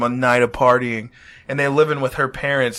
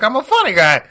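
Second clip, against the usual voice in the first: higher, louder mock menace; Joe Pesci impression; 8 semitones higher, 6 dB louder than usual. Tommy pressing the funny-guy line, louder mock menace. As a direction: push it like a touchy gangster. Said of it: "he's like".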